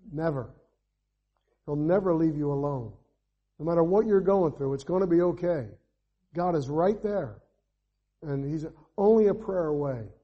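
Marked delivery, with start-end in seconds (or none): none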